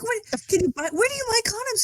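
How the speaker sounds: high, flustered panicky question; asking husband where to buy condoms, high panic; mock panic. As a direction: ask it in a high panicked rush.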